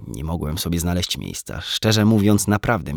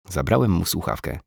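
ordinary delivery, nothing marked

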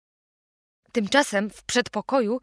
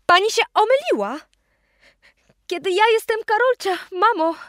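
higher, louder, pleading; pleading raised voice; 10 semitones higher, 5 dB louder than usual. A narrator's reading. Grown-up Karolcia begging her mother, raised and high. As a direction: beg mother to recognise her, voice up.

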